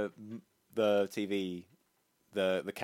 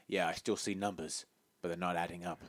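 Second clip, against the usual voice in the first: mildly mock-dramatic delivery, pitched down; lightly voiced action hero; 7 semitones lower, 8 dB quieter than usual. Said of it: "he stares dead at the camera".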